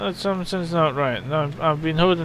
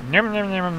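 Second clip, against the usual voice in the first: "nom, nom, nom" chomped out in a put-on cartoon eating voice; mock munching sound. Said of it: "that lawyer never makes that sound".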